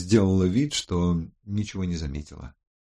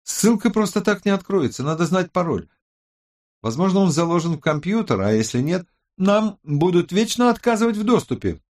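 high-pitched, animated woman's character voice; worried tech expert; 9 semitones higher than usual. A narrator's reading. Tech expert warns the team about the password, high and urgent. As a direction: explain the obstacle in a high, urgent voice.

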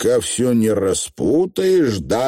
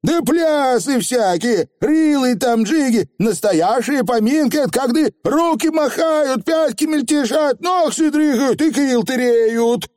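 высокий задорный голос коротышки; с жаром расписывай веселье, высоко; увлечённый фигль; 14 semitones higher than usual. A narrator's reading. Фигль описывает пляски. (увлечённый фигль)

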